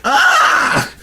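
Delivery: shrill mock scream of fright; high loud scream. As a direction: scream as the shark attacks. Land shark scream.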